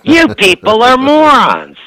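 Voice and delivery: high, loud hammed-up tirade; mother's outraged rant. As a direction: rail at everyone like an indignant mom. Mother scolds hospital staff.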